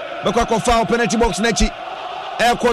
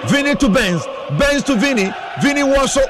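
rising, shouted play-by-play; excited commentary; 5 semitones higher than usual. Acted. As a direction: call the attack as it builds. Live play-by-play, build-up to a chance.

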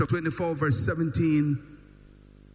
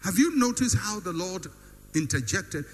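ordinary delivery

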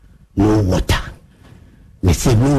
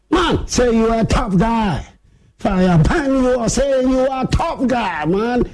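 high-pitched, heated taunt; fired-up challenge; 11 semitones higher than usual. Real, not acted. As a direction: throw down the challenge in a raised, high voice. Fired-up challenge to a tough guy.

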